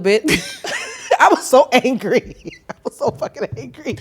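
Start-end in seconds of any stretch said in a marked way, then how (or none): 0.3-4.0 laughing through the words, higher pitch; amused recollection, breaking into laughs. Real, not acted. Woman laughing at how angry she once was, laughing. Laughing while recalling own anger.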